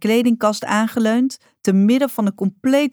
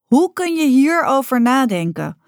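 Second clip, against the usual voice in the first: indignant outburst, much higher; rising anger; 7 semitones higher, 4 dB louder than usual. A narrator's reading. Rocca to her mother, anger rising. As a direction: let the anger bubble up. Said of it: "woede opborrelen".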